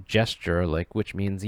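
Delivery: usual speaking voice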